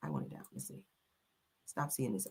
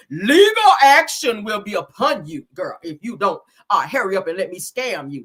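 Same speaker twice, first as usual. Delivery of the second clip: mock scammer threat; 6 dB louder than usual.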